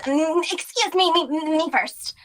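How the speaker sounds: put-on high, pushy old-lady voice; elbowing past in a high, haughty voice; high pushy cut-in. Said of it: "And she goes".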